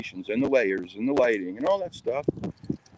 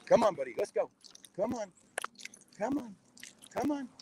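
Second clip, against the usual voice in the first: rising higher and softer with each call, coaxing the dog along; 12 semitones higher, 7 dB quieter than usual. Real, not acted. Calling his dog to come along, higher and softer. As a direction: come on, come on, sweet and high for a dog.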